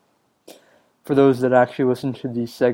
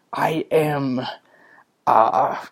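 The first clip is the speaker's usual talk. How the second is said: hammed-up pirate introducing himself; much slower than usual. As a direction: play at being a pirate captain. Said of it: "in a pirate's voice".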